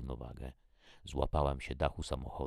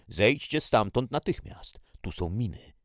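shouted warning; 7 semitones higher, 8 dB louder than usual. A narrator's reading. Man warning two sisters off a minefield, urgent shout. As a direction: shout them away from danger. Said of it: "który wcześniej do nas krzyczał".

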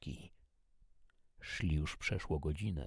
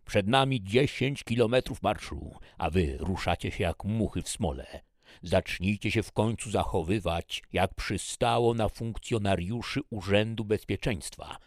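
loud, higher-pitched commanding harangue; shouting major; 5 semitones higher, 8 dB louder than usual. A narrator's reading. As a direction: yell over roaring engines to chew out the squad. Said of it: "wrzeszczał major Benson".

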